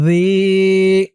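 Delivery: "জি" said as a soft, long dhee; tongue tip to upper teeth for "জি", like dhee; "জি" with a gentle th-as-in-this sound; very soft dhal. Shown as a demonstration. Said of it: "এই জালের উচ্চারণটা একদম নরম হবে".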